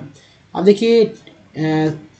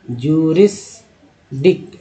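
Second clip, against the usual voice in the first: जूरिसडिक drawn out very slowly, syllable by syllable; slow syllable split; much slower than usual. Shown as a demonstration. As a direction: break जूरिसडिक apart slowly for students. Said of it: "next देखिए". (जूरिसडिक बहुत धीरे, टुकड़ों में)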